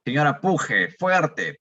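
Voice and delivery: doctor lightly acting out urging a patient to push; a hint of a coaching order to push hard. Lecturer imitating a doctor urging a patient, mild mock command. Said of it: "yo le pido a la paciente de que puje".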